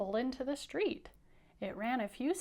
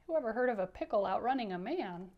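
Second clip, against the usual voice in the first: plain reading voice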